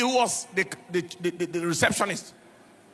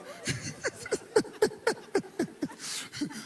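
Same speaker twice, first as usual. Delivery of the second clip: real high laugh; 10 semitones higher, 7 dB quieter than usual. Real, not acted. Father's order turned around, amused.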